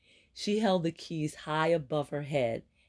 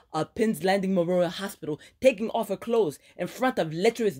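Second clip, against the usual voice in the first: furious friend ranting, higher and louder; angry scolding friend; 5 semitones higher, 4 dB louder than usual. Acted. Ileana ranting at Aaron about her ruined dinner, angry. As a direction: let her rip into her reckless friend.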